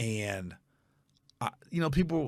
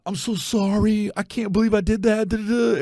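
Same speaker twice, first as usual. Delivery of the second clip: mock apology; 8 semitones higher than usual. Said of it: "she just apologized".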